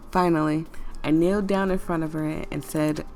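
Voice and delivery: ordinary delivery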